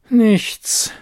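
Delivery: a resigned sigh on "Nichts". A narrator's reading. Loreen admitting defeat to the librarian, sighing. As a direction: give up with a sigh. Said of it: "seufzte sie".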